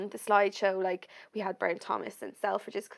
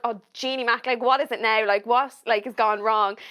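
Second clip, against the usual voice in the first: raised, louder worried questions; panicked questions; 2 semitones higher, 8 dB louder than usual. Retold or imitated. Retelling her answer to her friend's call, louder and alarmed. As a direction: fire off the questions as if bad news is coming.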